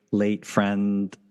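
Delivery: normal talking voice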